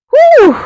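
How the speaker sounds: high, drawn-out "whew"; long loud whew. Real, not acted. Vlogger reacting to a heated fight, long high whew.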